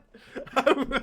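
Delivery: loud, high burst of laughter; real loud laugh; delighted laugh. Real, not acted. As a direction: laugh out loud, still thrilled. Laughing at the recalled burn.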